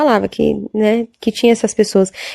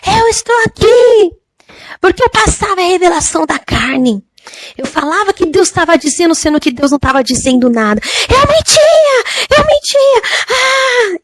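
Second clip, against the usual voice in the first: terrifying scream; 9 semitones higher than usual. Retold or imitated. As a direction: wail it out as a tormented woman in the fire. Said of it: "com um grito assim tão apavorante".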